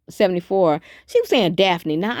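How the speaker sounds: usual speaking voice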